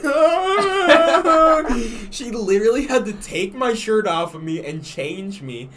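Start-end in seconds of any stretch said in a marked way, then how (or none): 0.0-1.7 high, loud mock drunken wail; hammed-up crying groan; imitating a sobbing drunk. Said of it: "And I'm like".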